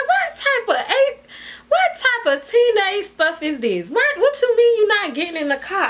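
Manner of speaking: high-pitched incredulous outburst; reenacted indignant rant, pitch way up; mad cussing out. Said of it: "I'm cussing him out 'cause I'm mad".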